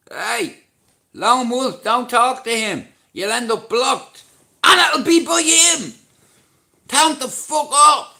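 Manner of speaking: very high, loud yelling; shouted warning. Real, not acted. Streamer warning Lone Wolf off talking to someone, shouted warning. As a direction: holler the warning at full volume. Warns viewer off troll, yelling.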